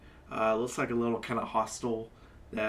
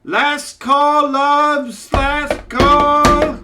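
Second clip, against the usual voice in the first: loud, much higher barmaid's cry; calling out last call; 18 dB louder, much slower than usual. Acted. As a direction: call it across the whole tavern. Barmaid calling closing time.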